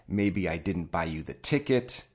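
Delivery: ordinary talk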